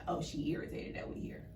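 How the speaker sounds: hushed whisper of a suspicion; whispered irritation. Retold or imitated. Mom's suspicion retold by cousin, hushed whisper. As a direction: whisper it conspiratorially. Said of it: "giving like".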